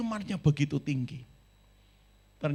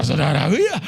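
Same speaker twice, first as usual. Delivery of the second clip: calling out to congregation; 11 dB louder than usual. Real, not acted. Preacher calls to the congregation.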